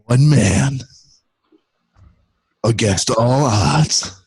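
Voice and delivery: very slow, dramatic trailer narration; movie trailer voice. Acted. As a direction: drag out each epic phrase. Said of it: "best Disney trailer voice".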